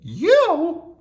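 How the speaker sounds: very high, loud squeak of disbelief; little Mouse. A narrator's reading. Mouse sizes up the tiny monster, high squeak. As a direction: squeak it incredulously. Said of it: "said Mouse".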